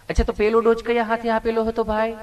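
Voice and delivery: a hint of a kind nurse; sweetly asking nurse. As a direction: play the nurse asking lovingly. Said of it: "નર્સબેને એવું પ્રેમથી પૂછ્યું".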